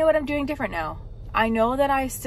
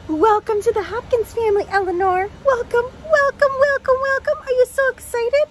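high, loud puppy-talk gushing; excited welcome; 10 semitones higher, 7 dB louder than usual. Real, not acted. Welcoming puppy Eleanor to the family, excited high baby talk. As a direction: cheer the puppy in, climbing higher and louder.

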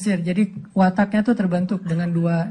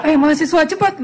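mock calling out; 8 semitones higher than usual. Driver calling to running students.

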